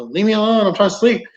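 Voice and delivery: retold annoyed complaint. Wife to husband in bed, annoyed. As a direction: imitate a wife fed up at being disturbed.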